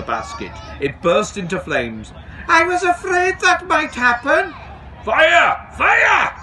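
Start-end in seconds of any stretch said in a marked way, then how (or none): 2.5-4.5 very high, loud squeaky dragon voice; dismayed dragon. A narrator's reading. Dragon sees the basket burst into flames, high dismayed squeak. 5.0-6.3 high, loud cry of alarm; alarmed shout. A narrator's reading. Station master sees flames and raises the alarm, high shout. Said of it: "shouted Dai".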